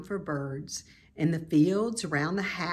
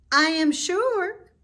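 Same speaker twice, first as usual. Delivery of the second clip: higher, slow, formal spider voice; Charlotte; 13 semitones higher, 7 dB louder, slower than usual. A narrator's reading. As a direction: announce it with polished dignity. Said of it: "she said".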